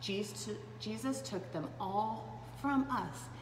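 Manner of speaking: ordinary delivery, nothing marked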